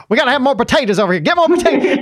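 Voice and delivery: rapid, high, hammed-up director's holler; fast-talking Southern director. Host imagining the director ordering potatoes, fast-talking Southern guy. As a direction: rattle it off like an excitable Southern director. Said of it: "fast-talking Southern guy".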